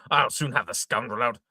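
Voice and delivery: usual speaking voice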